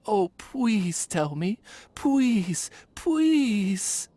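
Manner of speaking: rising, begging please please; pleading child. Acted.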